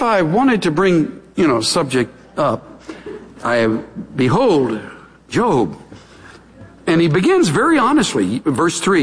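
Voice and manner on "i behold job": ordinary talk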